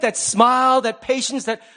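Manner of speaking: ordinary delivery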